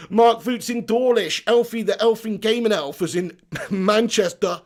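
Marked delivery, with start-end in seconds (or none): none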